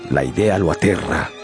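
ordinary talk

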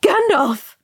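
high, loud cry of horror; horrified shriek. A narrator's reading. Frodo seeing the wizard caught, shrieking in horror. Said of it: "Frodo shrieked in horror".

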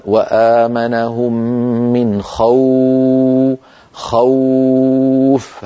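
خوف drawn out very slowly, the w held; Quran recitation. Shown as a demonstration. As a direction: recite وآمنهم من خوف stretching the w. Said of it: "لما نقول مثلًا". (تلاوة بإطالة واو خوف)

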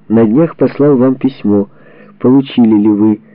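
normal talking voice

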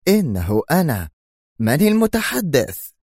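curt challenge; rough demand. Acted. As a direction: boss into the intercom. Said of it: "قائلا في خشونة".

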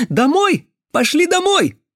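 very high, loud insistent cries; shouting boy. Acted. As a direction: urge her on in a high bird-like shout. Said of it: "закричал Венька". (настойчивый высокий крик)

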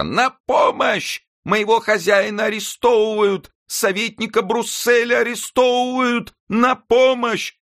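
very high, slow, loud calling out the window; piercing cry for help. A narrator's reading. Nanette screaming out the window for help, piercing and very high. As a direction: yell so the whole square hears. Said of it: "закричала таким пронзительным голосом".

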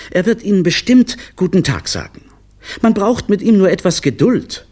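high, loud, eager clown voice; pleading little clown. Acted. Clown assuring the director about his son, high and eager. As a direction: plead eagerly, pitched right up.

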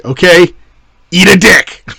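loud mock director calling action; mock director shout. Director calling the take on the zombie, loud mock shout. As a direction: bark it like a director on set. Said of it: "instead of saying action, was say".

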